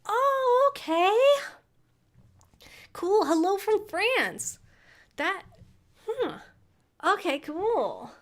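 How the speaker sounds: light up at the French viewer; surprised delight. Real, not acted. Streamer greeting a viewer from France, high and surprised.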